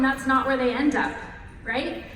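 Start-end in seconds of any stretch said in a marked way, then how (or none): none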